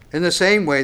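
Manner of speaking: ordinary talk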